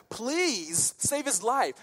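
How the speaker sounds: high, drawn-out mock plea; acted imploring; begging. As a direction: stretch the please as you beg for a life. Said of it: "they're begging".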